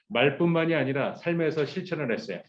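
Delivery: normal talking voice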